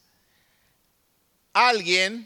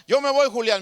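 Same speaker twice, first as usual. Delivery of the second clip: louder, higher insistence; angry refusal; 5 semitones higher, 5 dB louder than usual. Retold or imitated. Angry worker repeats to Julián he's leaving, loudly. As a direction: repeat it like a man storming out. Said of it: "Se enojó inmediatamente".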